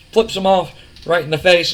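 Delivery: ordinary delivery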